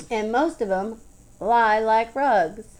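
"lie like rugs" drawn out slow and loud; scornful emphasis. A narrator's reading. Shelley warns the boy about men, stretching "lie like rugs" scornfully. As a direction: land hard and slowly on "lie like rugs".

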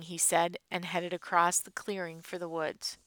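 plain reading voice

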